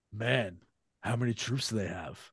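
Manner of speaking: put-on low, quiet American delivery with no accent; understated American actor, accent untouched. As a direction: drop it low and soft in a plain American accent. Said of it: "No accent at all".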